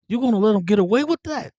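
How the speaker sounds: high-pitched egging on; mock goading. Jimmy egging Tommy on about Spider's insult, high goading. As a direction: goad him in a high, incredulous imitation.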